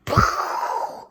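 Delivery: poof sound. A narrator's reading.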